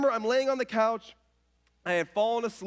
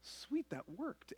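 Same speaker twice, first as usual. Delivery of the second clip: quiet relieved thought; 3 semitones higher, 14 dB quieter, slower than usual. Retold or imitated. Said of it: "And I think".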